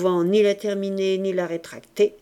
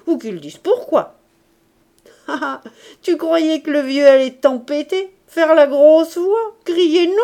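put-on teasing old grandfather; 3 semitones higher, 6 dB louder than usual. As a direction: play a blustering, doting grandfather. Voices the grandfather in the story.

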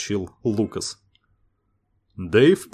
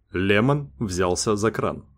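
plain reading voice

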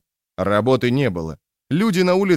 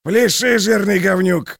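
high, loud barked order; barking shout; 9 semitones higher, 4 dB louder than usual. A narrator's reading. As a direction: bark it at the fat man. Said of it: "рявкнул он". (рявкающий крик)

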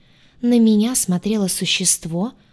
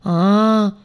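louder, snorted reply; snorting dismissively; 4 dB louder, slower than usual. A narrator's reading. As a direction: snort it off with a shrug. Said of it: "фыркнуло оно".

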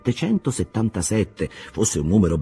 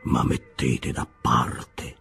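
Bildad's voice; 6 semitones lower, 4 dB quieter, slower than usual. A narrator's reading. Bildad murmurs scripture, slow.

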